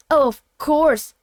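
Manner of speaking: bitter, pointed agreement, pushed loud; sharp interruption. A narrator's reading. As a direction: cut in sharply, stretching the words. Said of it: "interrupted the man sharply".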